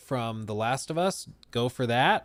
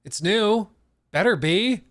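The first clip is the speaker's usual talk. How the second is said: sarcastic; 7 semitones higher, 9 dB louder, slower than usual. Retold or imitated.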